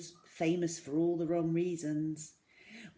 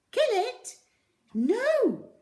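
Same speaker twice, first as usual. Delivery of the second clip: very high, loud cry of protest; horrified shriek; 8 dB louder, much slower than usual. A narrator's reading. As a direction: shriek it in horror. Zoe horrified at killing rat.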